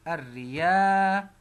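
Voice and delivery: الريا stretched slowly, each sound held; drawn-out syllables. Shown as a demonstration. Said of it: "أدي راء، شدة وكسرة".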